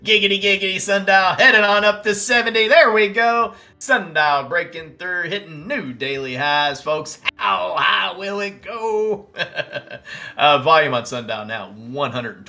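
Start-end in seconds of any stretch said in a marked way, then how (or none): 0.0-9.3 high, loud, stretched-out play-by-play; thrilled live call, building to a drawn-out shout; excited stock hype. Real, not acted. Hyping the live price surge. 9.3-10.3 breathy chuckle after a shout; excited laugh. Real, not acted. Laughs after his hyped shout.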